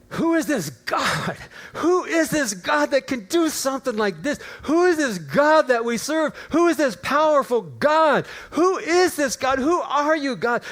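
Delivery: high, loud cries of amazement; exuberant praise. Retold or imitated. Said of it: "And I praised him".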